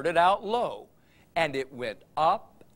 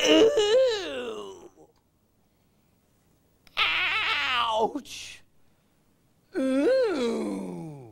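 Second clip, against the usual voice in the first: high moaning ouch after a crash; pained groans; 6 semitones higher, much slower than usual. Acted. Turtle hurt after crashing, groaning in pain.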